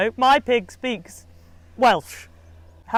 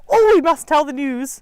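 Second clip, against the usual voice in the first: louder, higher gossipy exclamation; nosy villagers; 6 semitones higher, 5 dB louder than usual. Acted. Nosy villagers eager to spread news, mock-excited gossip. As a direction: mimic busybodies bursting to tell. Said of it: "all the nosy people in that town and village that go".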